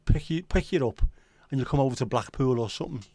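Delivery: normal talking voice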